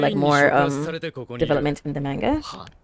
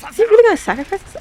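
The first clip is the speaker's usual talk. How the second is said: much higher, louder and fast, can't believe it; shocked disbelief; 14 semitones higher, 6 dB louder, faster than usual. Real, not acted. Viewer reacting to a sacrifice on screen, shocked and loud. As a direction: blurt it out in real shock.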